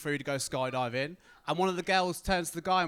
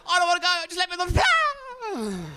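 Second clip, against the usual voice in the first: much higher, louder, slow wailing plea; mock panicking girl; 13 semitones higher, 8 dB louder than usual. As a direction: wail it out laughing at her. Girl pleading again.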